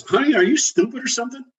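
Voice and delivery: imitating a blunt worker scolding an executive, high and sassy; exasperated retort. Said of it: "she says".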